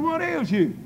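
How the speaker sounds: high-pitched incredulous demand; put-out officer, voice jumping up; indignant challenge. Retold or imitated. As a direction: retell an officer's baffled challenge. Officer challenging him over the cigar.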